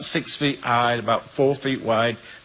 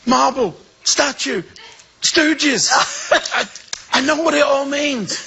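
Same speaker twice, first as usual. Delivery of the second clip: excited babble; 12 semitones higher than usual. Retold or imitated. Waking up and explaining the dream to his wife, high and excited. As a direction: blurt it out, thrilled.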